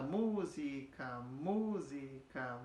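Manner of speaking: "Música" drawn out slowly, syllable by syllable, long "Mú"; metrical beat demo. Shown as a demonstration. Teacher testing música against two beats, "Música" in slow measured syllables. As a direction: count out "Mú-si-ca" slowly, leaning on "Mú". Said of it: "Se a gente ficasse com dois aqui".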